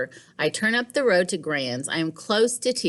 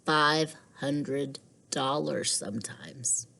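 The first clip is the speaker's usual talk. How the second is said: hushed; 3 semitones lower, 4 dB quieter, slower than usual. Acted. Liam voiced whispering.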